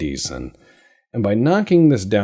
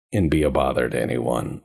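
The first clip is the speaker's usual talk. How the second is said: ordinary delivery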